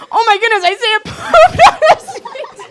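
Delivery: high, loud, laughing mock shock; shocked outcry. Friend spots a stain on the seat, mock-shocked. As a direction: exclaim it high, breaking into laughter.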